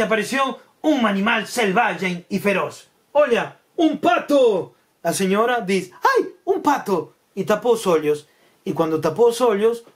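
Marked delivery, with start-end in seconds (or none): 3.1-4.7 high, drawn-out storyteller's announcement; big slow reveal, pitched up; mock-dramatic reveal. A narrator's reading. Announces the fierce beast, a duck. 6.0-7.1 high, drawn-out mock alarm; storybook fright, pitched way up; frightened lady.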